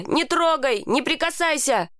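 high, urgent protest at modest volume; mild roar. A narrator's reading. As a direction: fend them off with a restrained cry. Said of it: "взревел я".

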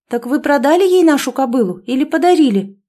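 insistent. A narrator's reading. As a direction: press the question hard. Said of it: "не унималась она".

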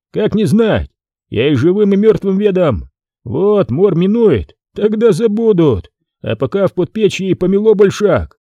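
a hint of a cocky peasant brushing off praise; mocking Foma. A narrator's reading.

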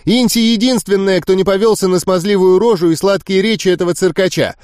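high, sneering and relentless; young witch mocking the crowd, high and scornful; persistent scorn. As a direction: taunt them like fools. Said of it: "не унималась волшебница".

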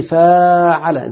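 فاعل with a very long aa after ف; stretched long vowel. Shown as a demonstration. Teacher showing students a verb pattern, فاعل with its aa greatly stretched. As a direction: draw out the alif in فاعل.

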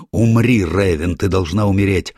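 loud, high, frenzied yell; murderous shout. Acted. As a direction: scream it like a madman. Madman lunges to kill her.

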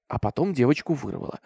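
ordinary delivery

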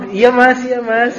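meek wife. Acted. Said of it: "istrinya bilang".

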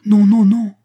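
high, loud, vehement protest; a young woman's vehement no; vehement cry. Acted. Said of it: "s'écria Agnès avec véhémence".

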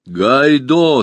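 high, loud call. A narrator's reading. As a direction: call the name loudly.

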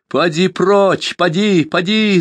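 high, loud, slow cry to send him away; anguished yell; shouting. A narrator's reading. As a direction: drive him off with a scream. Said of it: "закричала Тайка".

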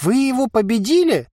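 high, astonished voice; amazed merchant; incredulous question. A narrator's reading. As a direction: ask in high-pitched disbelief. Merchant can't believe the giant lost.

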